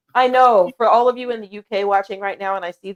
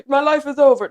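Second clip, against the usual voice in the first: hammed-up despair, higher and louder; mock melodrama; 5 semitones higher, 6 dB louder than usual. Mocking the easily offended, hammed-up melodrama. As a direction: overdramatic mock wail of devastation.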